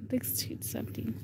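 hushed whisper; nervous night vlog whisper. Real, not acted. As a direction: whisper it under your breath. Exploring a dark abandoned house.

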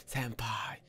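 soft, breath-only name; hushed lovesick whisper. Acted. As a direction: whisper it like a secret crush. Acting infatuated Yandere.

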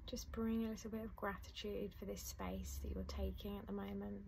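soft, unhurried meditation voice with long pauses; guided meditation. Acted. Teacher guiding listeners through a letting-go meditation, soft and calm. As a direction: ease the listener gently into calm.

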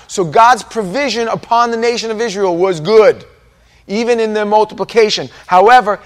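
loud, high-pitched preaching; full-force preaching. Acted. Preacher to congregation about Israel's forty years, loud and impassioned. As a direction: drive each point home to the congregation at full volume.

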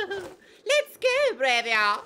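loud, high giggle into a shout; giggling game child. Acted. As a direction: squeal with laughter and call out. Sabi runs off giggling in game.

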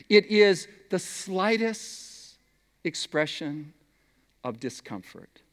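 dropping slowly to very soft; hushed. Shown as a demonstration. Preacher showing how slight a sigh is, hushed. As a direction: let it shrink away, slow and faint.

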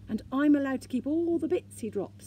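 high, loud, proud announcement. A narrator's reading. Sniff claiming his share of gold, proud. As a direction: crow about it with lifted pitch. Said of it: "said Sniff proudly".

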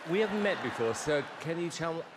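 ordinary talk

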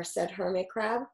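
usual speaking voice

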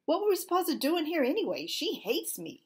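ordinary delivery, nothing marked

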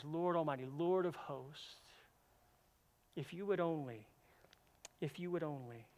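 soft, pleading prayer in her voice; quietly begging the Lord of hosts; hushed plea to God. Retold or imitated. Hannah crying out to God, a soft, pleading prayer. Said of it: "she's crying out to him".

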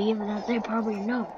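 normal talking voice

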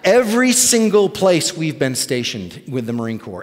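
ordinary talk, nothing marked

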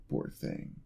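soft, low breathy whisper; hushed mock sympathy; whispered pity. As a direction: lean in and whisper it. Pitying the tired listener.

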